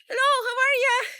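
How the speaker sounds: high, loud, put-on girly hello; mock sweet greeting. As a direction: sing out a fake-friendly hi, mocking them. Mocking the other girls' greeting.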